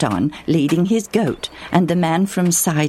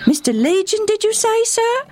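put-on high, bright young boy voice. A boy cheerfully answers the stranger, high and bright. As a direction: answer like an eager little boy.

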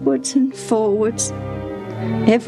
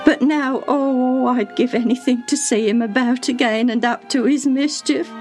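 with a sob; 7 semitones higher than usual. A narrator's reading. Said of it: "she said with another sob".

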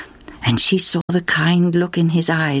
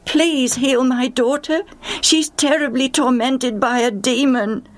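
higher pleading call, slowed on the key words; 8 semitones higher than usual. Acted. Mother calling out to Jesus for her daughter, pleading. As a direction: call across to Jesus, begging. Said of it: "she began to call out".

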